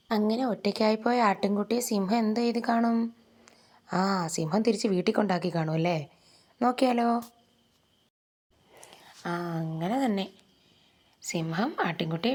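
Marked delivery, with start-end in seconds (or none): none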